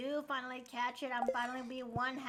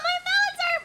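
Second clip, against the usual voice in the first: high, loud surprised burst; excited; 10 dB louder than usual. Real, not acted. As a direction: blurt out in surprise. Sudden excited exclamation.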